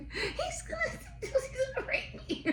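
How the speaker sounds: high squealing fake fright through laughter; hammed-up panicked wail; mock terror. The woman imagined panicking about the boss, mock terrified.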